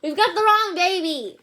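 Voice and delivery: high, loud panicked shout; alarmed shout. Acted. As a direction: shout it in dismay. Parents panic over wrong baby.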